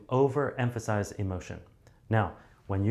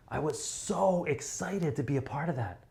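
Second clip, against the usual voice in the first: higher, exaggerated thrill; big excitement; 7 semitones higher than usual. Shown as a demonstration. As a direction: sell it to a huge crowd. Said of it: "If it's fifteen thousand people, you'll be like".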